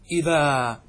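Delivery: إذا held on a long "aa"; open, drawn-out ending on إذا; pausal alif. Shown as a demonstration. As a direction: say إذا as "idhaa", without any n. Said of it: "فأبدلوا نونها ألفا في الوقف".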